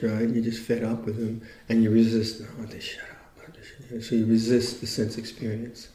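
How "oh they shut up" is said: whispered under the breath, drawn out; muttered inner protest. Acted. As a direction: mutter it like a private thought of fed-up resistance. Voicing inner resistance to rubbish talk.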